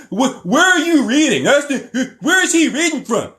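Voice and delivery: high-pitched, whiny mock confusion; mocking chat viewers. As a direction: squeal it like a clueless, pestering viewer. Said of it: "I don't want anybody in the chat".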